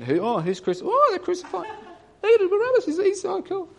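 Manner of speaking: high, giddy voice of a thrill-seeking onlooker; mocking onlooker. Mocking the crowd at the crucifixion.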